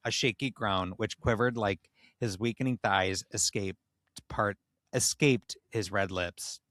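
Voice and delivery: ordinary talk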